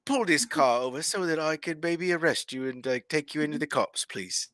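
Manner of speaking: polite British request, starting very high; British Batman. Acted. Guest plays British Batman politely arresting someone, British accent. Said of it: "if Batman had a British accent".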